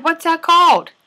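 plain reading voice

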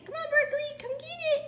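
high, loud sing-song dog call; calling the dog for her toddler. Shown as a demonstration. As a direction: coax the dog like a toddler would.

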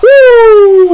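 normal talking voice

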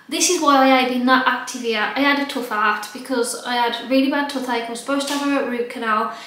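normal talking voice

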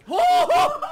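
loud high burst of laughter; shouting laugh. Real, not acted. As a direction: laugh out loud after the scare. Sudden scare then laughter.

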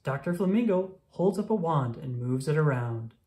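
ordinary delivery

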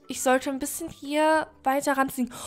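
breathless hiding whisper. Real, not acted. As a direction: whisper so nobody hears you. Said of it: "jetzt müssen wir echt leise sein". (leises Flüstern)

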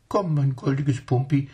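high prim lady doting and scolding; lady talking to her pet monkeys; fussy lady. Acted.

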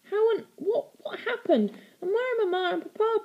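put-on high, desperate child's questions; frantic girl begging for answers; pleading. A narrator's reading. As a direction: let the questions tumble out in desperation. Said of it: "pleaded Stella".

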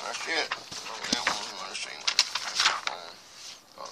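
very soft, low mumbling; half under the breath, barely voiced; talking to oneself. Real, not acted. Vlogger sorting papers to himself, quiet self-talk.

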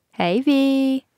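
high, happy party greeting; smiling greeting. A narrator's reading. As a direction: sing out a delighted hello. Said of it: "still dancing and smiling from ear to ear".

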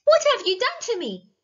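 high, loud horrified squeal. A narrator's reading. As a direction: squeal in outrage at the witch. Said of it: "squealed the princess".

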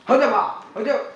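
chanted nonsense war cry; silly battle cry. Acted. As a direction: shout the nonsense word playfully.

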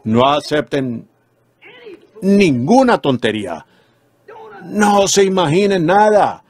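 slow, heavily weighted preacher's warning; solemn warning. Real, not acted. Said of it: "Permítanme advertirles ahora como ministro". (advertencia solemne, lenta y enfática)

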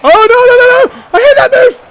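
very high, loud wail; panicked cry. Real, not acted. Player facing a hated monster move, panicked cry. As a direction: cry out as the attack comes.

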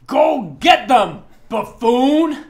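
high, loud, slow bellow in broken Nodish; broken Nodish shout. Acted. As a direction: roar the order from the side in broken Nodish. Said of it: "the broken Nodish from the side say".

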